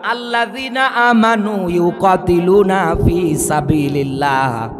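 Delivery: usual speaking voice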